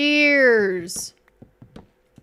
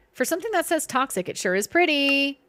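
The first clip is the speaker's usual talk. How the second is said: ordinary talk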